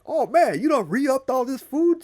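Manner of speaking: high joyful surprise; delighted surprise. Acted. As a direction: gasp at the restocked cupboards. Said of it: "it's like".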